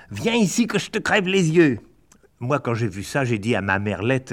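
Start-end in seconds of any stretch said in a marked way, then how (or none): 0.1-1.8 mild mock yell. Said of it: "en criant".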